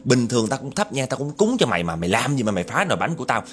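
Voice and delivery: acted tirade, firing off complaints; angry scolding. Cake seller cursing the ghost over ruined cakes, acted angry scolding. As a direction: scold like a fed-up market woman. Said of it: "Bả chửi quá trời chửi luôn".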